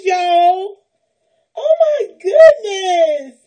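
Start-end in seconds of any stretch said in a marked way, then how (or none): 1.6-3.4 slow, drawn-out tearful cry; tearful exclamation. Real, not acted. Finding clothes in a gift bag, tearful joy. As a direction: stretch it out through tears.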